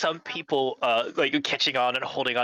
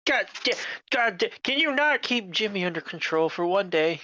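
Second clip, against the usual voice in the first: exasperated boss; 7 semitones higher than usual. Acted. Supervisor angry at worker.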